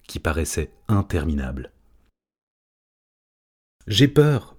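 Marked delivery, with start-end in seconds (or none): 3.9-4.6 high, small scared chick voice; a little gull peeping her fear; frightened chirp. A narrator's reading. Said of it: "pépilla Fortunada".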